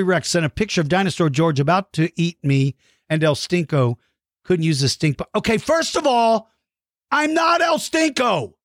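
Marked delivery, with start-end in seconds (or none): 5.4-8.5 high, outraged protest; indignant, pitched up; indignant denial. Pretend. Denies being El Stinko.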